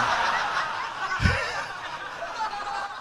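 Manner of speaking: a long, soft, high chuckle; high laugh. Real, not acted. Joking with the audience about God's words, soft high laughter. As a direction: laugh softly and high at your own joke. Laughs at his own remark.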